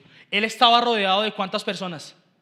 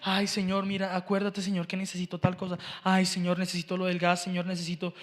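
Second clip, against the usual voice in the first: low, soft mumbled litany; half-hearted prayer; 5 semitones lower, 7 dB quieter than usual. Acted. Preacher imitating the praying crowd, half-hearted murmur. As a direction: murmur it like a routine request list. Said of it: "todas pueden estar diciendo".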